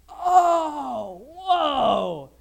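a loud, high, drawn-out whoa; amazed whoa. Retold or imitated. Onlookers seeing the oil strike, loud amazed whoa. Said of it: "everybody's like".

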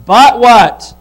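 loud, slow scripture proclamation; fiery preaching. Acted. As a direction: build the promise with rising fire. Reading scripture, preaching at full force.

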